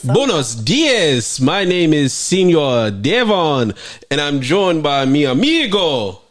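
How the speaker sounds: put-on slow hammy Spanish accent; fake Spanish accent. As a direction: lay on a bad Spanish accent. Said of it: "that's the nastiest accent ever".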